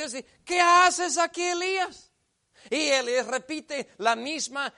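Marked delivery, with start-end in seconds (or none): 0.4-2.1 higher, louder insistent question; God asking again. Acted. God to Elías a second time, louder and insistent. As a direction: press him as God.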